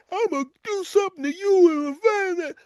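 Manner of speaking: high strained yelling rage; angry road rager. Retold or imitated. Road rager gets out of car.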